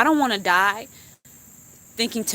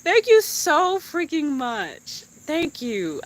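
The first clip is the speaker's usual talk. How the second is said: high, slow, near tears; tearful thanks; 7 semitones higher, much slower than usual. Real, not acted. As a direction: stretch it out moved and grateful. Says she could cry.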